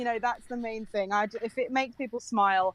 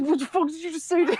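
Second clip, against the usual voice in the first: laughing mock outrage; 6 semitones higher, 3 dB louder than usual. Voicing dog's reaction, laughing.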